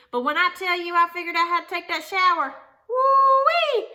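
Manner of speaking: bursting with pride, woo-wee up high and loud; excited exclaim. Real, not acted. Telling her viewers about the shower, a triumphant whoop. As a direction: crow about the big win.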